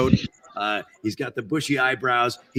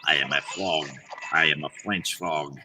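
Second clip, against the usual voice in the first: put-on lower-pitched French accent on a snooty frog; 8 semitones lower than usual. Voice actor trying a French accent for a fancy frog. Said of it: "some sort of a foreign accent".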